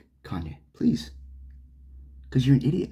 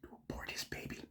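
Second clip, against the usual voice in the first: whispered; 23 dB quieter, faster than usual. Real, not acted. Rant aside, dropped voice.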